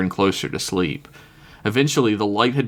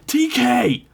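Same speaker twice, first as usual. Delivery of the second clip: high, urgent yell of a name. Acted. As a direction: call out to wake her. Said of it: "Alyssa yelled out".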